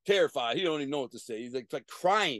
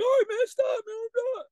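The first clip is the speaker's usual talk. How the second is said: very high, loud whiny plea; mocking a beaten guy begging; begging victim; 5 dB louder than usual. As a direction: beg like a beaten man. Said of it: "He's begging me".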